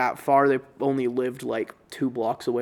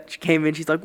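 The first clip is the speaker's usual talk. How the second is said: normal talking voice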